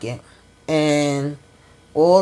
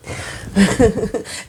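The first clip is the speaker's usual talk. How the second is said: real laugh cracking up at the story; burst of laughter; 4 semitones higher than usual. Real, not acted. Laughing at her own retelling.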